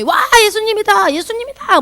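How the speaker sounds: high-pitched gleeful cheer; imitating excited children calling out; children's excited shouting. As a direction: yell it like a kid spotting a celebrity. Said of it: "애들도 소리 지를 수 있고".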